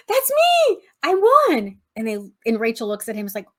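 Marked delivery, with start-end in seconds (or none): 0.1-1.8 much higher, louder eager shout; excited Joey. Retold or imitated. Joey thinks he won the auction, thrilled shout. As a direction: blurt it like a thrilled kid winning a prize. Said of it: "Joey perks up and he's like".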